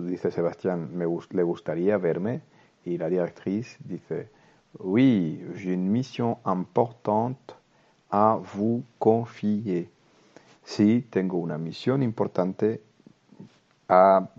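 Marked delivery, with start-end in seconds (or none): 4.8-9.9 slow, careful French reading for learners. Shown as a demonstration. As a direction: read each word slowly so students can follow. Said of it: "leer el diálogo muy despacio". (lectura muy lenta y clara)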